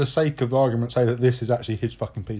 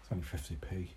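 quiet; 8 semitones lower, 19 dB quieter than usual. Real, not acted. Echoing price, sarcastic aside.

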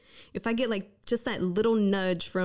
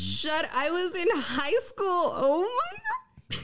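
amused disbelief; 9 semitones higher than usual. Real, not acted. Realizing she was in high school then, shocked. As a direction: exclaim it high, half laughing in disbelief.

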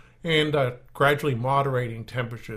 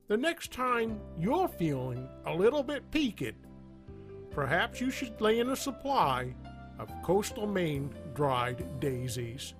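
upbeat radio commercial voice; ad read; 4 semitones higher, 4 dB quieter than usual. Acted. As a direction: sell it like a cheesy radio ad. Sponsor ad read over music.

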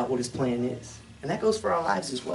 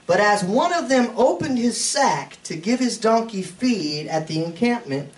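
slow, raised Bible reading voice; scripture reading; 6 semitones higher, 4 dB louder than usual. Acted. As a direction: read the verse out slowly, with weight. Reads scripture aloud in sermon.

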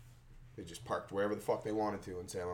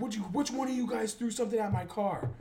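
higher accusing demand; mock angry driver; 10 semitones higher than usual. Driver demanding who threw it, mock accusation. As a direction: grill the kids like the angry guy.

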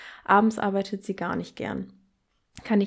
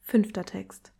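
normal talking voice

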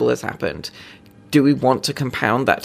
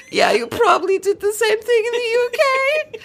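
much higher mock Norwegian, breaking into laughter; bad Norwegian accent. Norwegian police chief teasing the UK, bad Norwegian accent laughing. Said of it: "I'm gonna do a bad accent".

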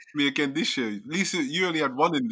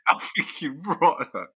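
higher, louder, fighting back laughter; protesting while cracking up; helpless laughing; 6 semitones higher, 4 dB louder than usual. Real, not acted. Retelling a film beating to friends, laughing helplessly. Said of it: "I don't even know why I'm laughing".

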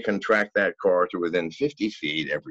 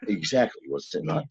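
ordinary talk, nothing marked

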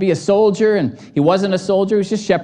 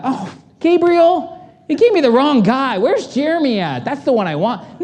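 higher, bumbling heavenly voice; mock confused God; 6 semitones higher than usual. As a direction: play God as a muddled boss. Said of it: "he wasn't up in heaven going".

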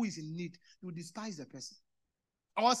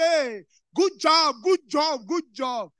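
loud, high, slow yelled good job; shouted praise; 5 semitones higher, 13 dB louder, much slower than usual. Retold or imitated. Boss ignoring the request to keep it small, loud slow shout. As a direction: bark the praise across the room. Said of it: "he comes around and says".

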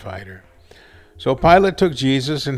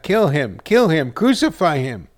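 louder, higher narrated shout; raised voice, insistent and punched; crowd shouting back; 5 semitones higher, 6 dB louder than usual. A narrator's reading. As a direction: call out like a mob demanding death. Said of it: "They shouted back".

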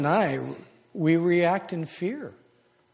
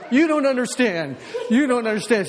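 mock panic; 7 semitones higher than usual. His panicked retort to wife.

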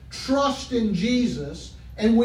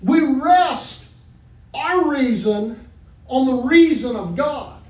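loud, higher fervent preaching; preaching at full force; 4 semitones higher, 3 dB louder than usual. Acted. Preacher urging the church to trust God, fervent. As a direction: lift the congregation with fire.

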